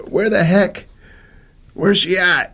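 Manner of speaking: put-on high, gruff grumbling of an impatient old man; hammed-up cranky old client demanding where she is; grumpy old man. A student left waiting, mimicking a grumpy old man. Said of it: "he's all grumpy old man".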